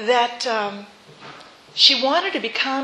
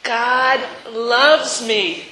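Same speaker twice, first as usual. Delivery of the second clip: drawn out and louder, proclaiming; slow and loud; 5 dB louder, much slower than usual. Shown as a demonstration. Preacher leading the final affirmation, slow and ringing. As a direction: declare it slowly with full force. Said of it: "One more time".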